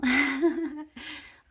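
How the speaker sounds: a high, loud laugh bursting out through tears; emotional laugh. Real, not acted. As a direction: laugh off a wave of feeling. Laughs after admitting emotion.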